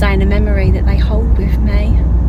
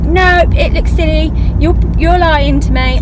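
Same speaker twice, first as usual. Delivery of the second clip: put-on upset boy; 7 semitones higher than usual. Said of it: "he was like".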